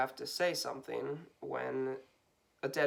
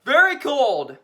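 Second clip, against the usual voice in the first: shouting; 9 semitones higher, 12 dB louder, slower than usual. Pretend. Echoing the shout.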